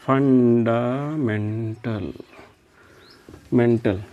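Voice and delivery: slowly dictated fundamental; slow drawn-out term. Shown as a demonstration.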